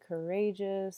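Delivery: usual speaking voice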